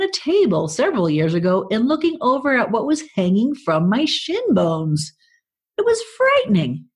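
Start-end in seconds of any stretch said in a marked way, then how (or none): 5.8-6.9 high squeaky mock fright; mock horror.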